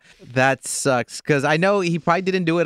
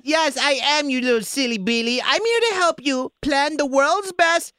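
high, bubbly put-on voice; party planner voice; 12 semitones higher than usual. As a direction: play an overexcited party planner. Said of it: "pretend that I'm a party planner".

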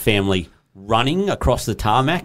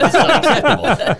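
mock outrage; 13 semitones higher than usual. Mocking outraged husband.

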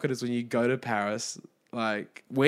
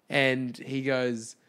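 ordinary talk

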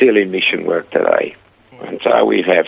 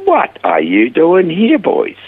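surprised greeting; 9 semitones higher, slower than usual. Retold or imitated. To the two runaway boys in the hospital office, surprised. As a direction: let the surprise at seeing them stretch out.

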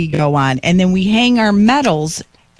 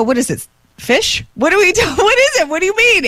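rising, bewildered questions climbing higher; laughing confusion getting higher; confused; 11 semitones higher than usual. Retold or imitated. Wife baffled by the sign's spelling, rising bewilderment. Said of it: "I go".

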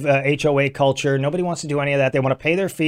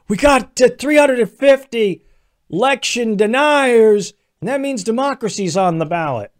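hammed-up Biden voice; mock Biden; 7 semitones higher than usual. Mocking Biden on the campaign trail, high and slow. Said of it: "Joe Biden's democracy's on the ballot".

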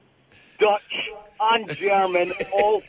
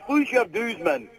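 Scottish accent, pitched higher; Scottish dialect question; 6 semitones higher than usual. Shown as a demonstration. Scot tests host with dialect phrase.

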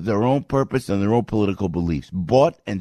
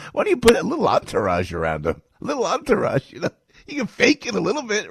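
mock suggestion; 13 semitones higher than usual. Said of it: "And he goes".

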